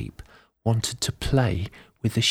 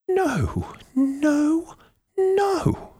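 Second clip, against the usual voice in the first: high, rising triple no from the granny; old lady protesting; much slower than usual. A narrator's reading. Old lady refuses the sheep's doubt, rising high granny protest. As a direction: climb higher on each no, old lady insisting. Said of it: "the old lady said".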